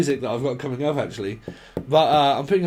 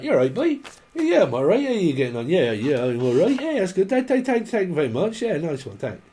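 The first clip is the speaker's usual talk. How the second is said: mock Cornish back-and-forth; Cornish accent; 4 semitones higher than usual. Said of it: "right proper accent".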